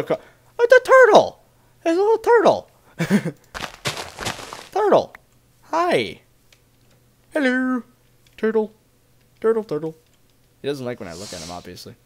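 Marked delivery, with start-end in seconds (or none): 0.6-10.0 much higher, squealing with delight at the turtle; excited squeal. Real, not acted. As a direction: gush over a tiny cute turtle. Spots a cute turtle.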